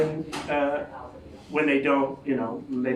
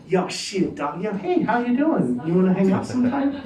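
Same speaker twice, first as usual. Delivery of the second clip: starts mock-thuggish, ends pitched up and chirpy; 5 semitones higher than usual. Caught in the mirror, the kid switches voices mid-sentence. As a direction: slide from street slang to preppy. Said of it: "I was like".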